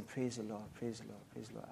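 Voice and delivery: murmuring praise the Lord very softly; hushed and reverent, almost under breath; soft murmured praise. Retold or imitated. Mother at hospital bedside murmuring, soft reverent praise. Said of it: "all I heard was her saying".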